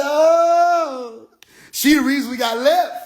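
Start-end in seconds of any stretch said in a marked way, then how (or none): 0.0-1.3 high startled oh; mock surprise. Hearing the bad greeting, high startled oh. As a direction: react with a high shocked oh. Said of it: "I said".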